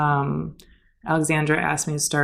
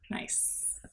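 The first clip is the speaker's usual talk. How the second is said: whispered, impressed; soft whispered nice; whispered approval; 20 dB quieter than usual. Retold or imitated. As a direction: hush it out admiringly. Admiring his pink sunglasses.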